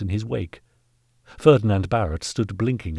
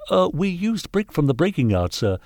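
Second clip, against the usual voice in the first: much higher, hesitant underling voice; nervous caretaker; 9 semitones higher, 3 dB louder than usual. Acted. Caretaker offering an excuse to the knight, nervously. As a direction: offer it timidly, pitched up high.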